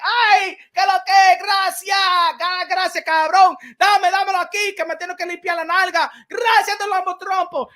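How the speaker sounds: mock crowd catching paper, high and loud; excited Puerto Rican catcher. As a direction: shout thanks for the toilet paper. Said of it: "it was catching like".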